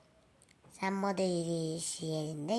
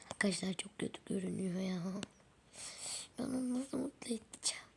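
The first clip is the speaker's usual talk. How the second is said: hushed aside to the audience; whispered aside to viewers about the sick doll; 6 dB quieter, faster than usual. Real, not acted. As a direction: whisper it so the doll won't hear.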